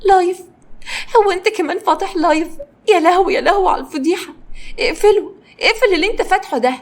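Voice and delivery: high, horrified disbelief; aghast at being filmed live; scandalised shriek held high; stunned dismay. A narrator's reading. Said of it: "كارمن بصت له بذهول".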